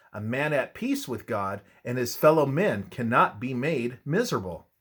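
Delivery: ordinary talk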